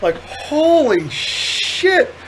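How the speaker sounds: stretched-out amazement at the pretzels; amazed. Real, not acted. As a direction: let the disbelief stretch out. Stunned by pretzel handfuls.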